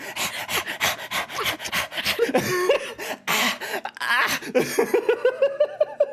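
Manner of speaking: rapid high panting yelps; chihuahua in heat. Shown as a demonstration. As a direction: hyperventilate like an overexcited little dog. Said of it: "Chihuahua in Hit, just like".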